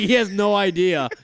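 usual speaking voice

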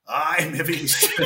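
slow, amused words broken by a laugh; laughing through it. Retold or imitated. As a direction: chuckle it out while teasing. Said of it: "see you laughing, kinda going".

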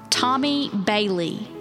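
normal talking voice

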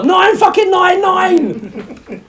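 high, loud, slow laughing yell; mock emergency call. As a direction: shout for an ambulance in jest.

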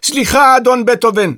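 high, loud apology; shouted apology from the maid; shouting. A narrator's reading. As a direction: call it out to someone hard of hearing. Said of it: "צעקה העוזרת".

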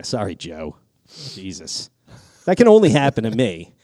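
usual speaking voice

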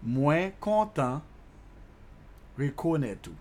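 very slow teaching demo; slow, measured phrase for learners. Shown as a demonstration. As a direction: stretch it out, pausing before "rekonet ou". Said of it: "let me slow that down a little bit".

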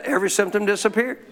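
ordinary talk

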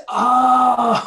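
loud, high "oh" breaking into laughter; big realization "oh". Retold or imitated. Speaker finally working out why she was mad, laughing outburst. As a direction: burst out with a laughing oh-ho-ho.

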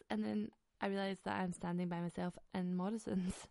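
ordinary talk

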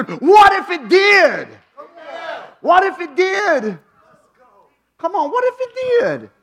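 loud, high, drawn-out challenge; rising shout. Real, not acted. Preacher shouting the question at the church. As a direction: hammer the question at the crowd.

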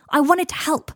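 heated protest. Acted. Harry defending himself to Draco, heated protest. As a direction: protest it loudly.

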